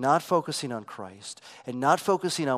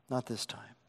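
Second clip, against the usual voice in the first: much softer echo, nearly dropped away; hushed; 3 semitones lower, 10 dB quieter than usual. Shown as a demonstration. Preacher hushing the repeated denial. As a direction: let the repeat fall almost silent.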